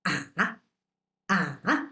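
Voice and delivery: ah dipping low, then rising; a low dip on ah; third tone. Shown as a demonstration. Teacher showing the dipping third tone. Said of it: "The third tone".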